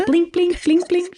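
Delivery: high comic blink noises; mimicked blinking. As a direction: voice the blinks as a sound effect. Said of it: "I could hear the blink".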